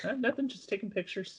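very high, soft mock-innocent reply; hushed, high-pitched cover story; feigned innocence. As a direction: play caught out and harmless, small high voice. Photographers caught, answering the guards.

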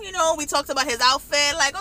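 ordinary talk

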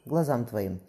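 ordinary delivery, nothing marked

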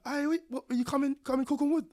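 high, fast, excited question; excited youth voice. Retold or imitated. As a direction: rattle it off in surprise. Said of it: "He's like".

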